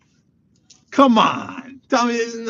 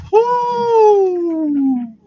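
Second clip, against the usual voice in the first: cold water shock; 9 dB louder than usual. Real, not acted.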